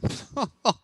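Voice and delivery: a kind, not mocking, laugh; gentle laugh imitation. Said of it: "he just laughs".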